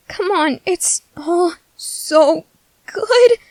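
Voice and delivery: drawn-out, rising lament over food; longing whine. Acted. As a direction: whine it slowly with swelling longing. Food craving, acted whining plea.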